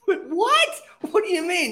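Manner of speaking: jolted upward in shock; stunned disbelief. Retold or imitated.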